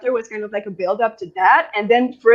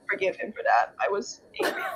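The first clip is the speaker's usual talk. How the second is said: whispered admission; 3 semitones lower, 11 dB quieter than usual. Real, not acted. Book club reader admitting her grudge, whispered. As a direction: drop to a breathless whisper.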